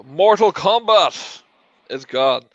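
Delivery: normal talking voice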